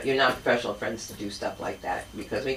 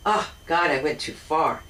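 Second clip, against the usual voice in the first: usual speaking voice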